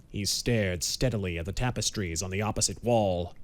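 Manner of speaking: usual speaking voice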